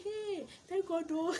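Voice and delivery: high, slow, drawn-out relief; high drawn-out thanks; relieved exclaim. Retold or imitated. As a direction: stretch out the thank God like a sigh of relief.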